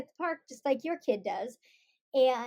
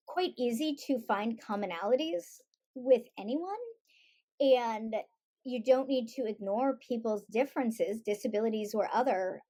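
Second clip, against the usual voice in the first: ordinary talk, nothing marked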